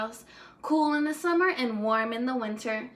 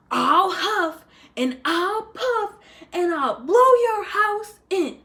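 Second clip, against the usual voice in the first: loud, higher, slow gloating threat; big bad coyote threat; 7 semitones higher, 8 dB louder, slower than usual. Coyote threatening the adobe house, loud rising menace. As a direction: build up to the blow like a villain.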